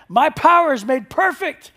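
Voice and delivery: "My power is made perfect" big and high with stress on power; big poster letters. Shown as a demonstration. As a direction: boom "My power is made perfect" like bold headline text. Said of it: "how about this one".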